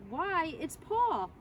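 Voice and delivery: high, slow amazed call; surprised shout. A narrator's reading. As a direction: draw out the surprise. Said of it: "the shouts went up".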